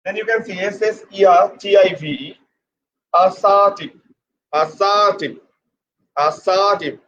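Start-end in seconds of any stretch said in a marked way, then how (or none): 3.1-7.0 ASSERTIVE as a-SAA-tiv, long middle, falling end; stress demonstration. Shown as a demonstration. Teacher showing students the stress, stressed a-SAA-tiv. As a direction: lengthen and push the second syllable of ASSERTIVE. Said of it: "What is the stress?".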